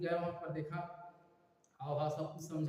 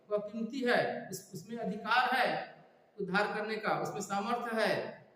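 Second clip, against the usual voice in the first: higher, emphatic proclamation in driving phrases; forceful preaching; 4 semitones higher than usual. Real, not acted. Preacher promises salvation through the blood of Jesus, forceful preaching. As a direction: proclaim it with conviction to the whole congregation.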